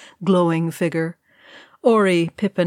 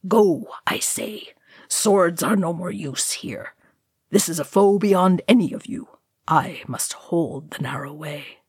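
Gandalf voice. Acted. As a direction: hurry them off gravely. Gandalf urges fellowship to flee.

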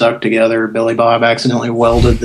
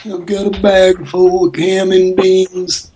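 higher, slow goofy put-on voice; prank caller voice; 8 semitones higher, slower than usual. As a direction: drag it out in a silly voice.

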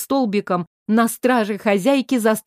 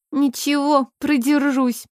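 sleepy; 4 semitones higher than usual. A narrator's reading. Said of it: "сонно заверила она".

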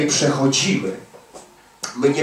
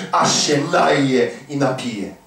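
slow emphatic reading; much slower than usual. Shown as a demonstration. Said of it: "repeated verse".

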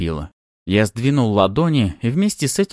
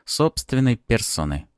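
plain reading voice

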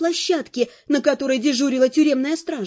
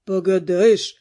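1 prisoner's voice; 6 semitones lower, slower than usual. A narrator's reading. Prisoner answering, voiced low.

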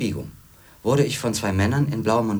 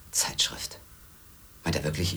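geflüstert; 4 semitones lower, 5 dB quieter than usual. Acted. Verächtlicher Gedanke, kaum hörbar.

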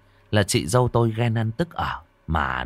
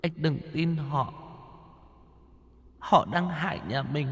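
high, soft wailing ghost; eerie ghostly voice; 8 semitones higher, 7 dB quieter than usual. Acted. Ghost wife softly begging her husband for help. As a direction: plead from beyond the grave, rising. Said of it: "giọng nói âm u sâu thẳm".

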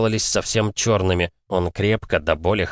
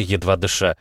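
plain reading voice

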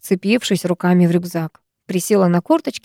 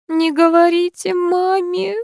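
put-on high, slow, tearful plea of a little girl; a crying first-grader begging them not to tell her mother; crying child; 10 semitones higher, slower than usual. A narrator's reading. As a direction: beg through tears like a frightened first-grader. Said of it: "продолжала плакать первоклассница".

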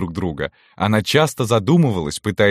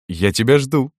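playful; 4 semitones higher than usual. A narrator's reading. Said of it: "игриво произношу я".

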